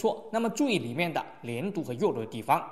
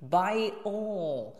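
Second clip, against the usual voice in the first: slow "Buy all", pitched higher; stress on ALL; 4 semitones higher than usual. Shown as a demonstration. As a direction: give "all" weight and height. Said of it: "这个all也要重一些". ("all"读重一些、高一些)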